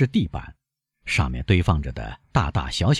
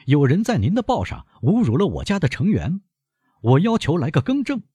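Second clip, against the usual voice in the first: a blunt, pitched-up demand; firm, even rude; 11 semitones higher than usual. A narrator's reading. Albert demands a retraction from his friend, firm and blunt. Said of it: "坚决地，甚至粗鲁地说".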